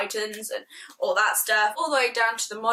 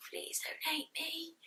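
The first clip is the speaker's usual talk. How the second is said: whispered, slow plea, much softer; 6 semitones higher, 14 dB quieter, slower than usual. Real, not acted. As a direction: beg in a whisper. Pleading with friend Kyle.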